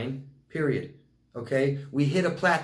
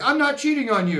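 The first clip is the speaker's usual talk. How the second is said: mock defensive partner; 10 semitones higher, 6 dB louder than usual. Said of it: "And they're like".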